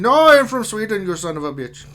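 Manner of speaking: high, loud fake Swedish that sounds Indian; botched Swedish accent. Insisting on being from Sweden, botched Swedish accent. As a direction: protest in a badly faked Swedish accent. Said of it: "Are you sure it sounds Indian".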